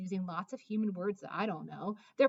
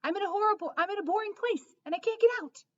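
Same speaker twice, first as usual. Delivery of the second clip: much higher, louder frantic dog voice; high, loud yapping plea; dog barking words; 12 semitones higher, 8 dB louder, faster than usual. Acted. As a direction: bark it out like a frantic pup. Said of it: "I bark".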